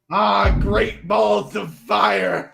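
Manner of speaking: slow, loud, hammy exclamation; hammed-up shout. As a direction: bellow it like an old-timey cowboy.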